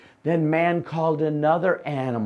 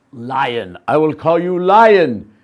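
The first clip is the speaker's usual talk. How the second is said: loud naming; 9 dB louder than usual. Acted. Man naming the lion loudly.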